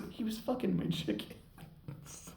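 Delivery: slow, whispered, amused repeat; breathy repeat. Shown as a demonstration. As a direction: savor the line under your breath. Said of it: "I'm so hard at that".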